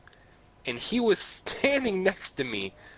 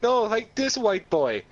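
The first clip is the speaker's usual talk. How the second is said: lightly imitated Jamaican accent, raised at the start; 6 semitones higher than usual. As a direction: quote him with a touch of Jamaican accent. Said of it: "had this very distinct Jamaican accent".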